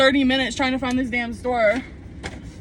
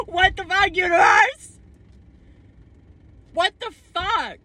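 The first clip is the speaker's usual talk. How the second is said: squeaky, much higher outcry; high-pitched disbelief; 9 semitones higher than usual. Real, not acted. Reeling after seeing an ex with his wife, high-pitched disbelief. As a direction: wail it at the universe, voice way up.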